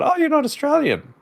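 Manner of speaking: high surprised woman's voice; high mock-surprised woman. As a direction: squeak it out in disbelief. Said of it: "and she said".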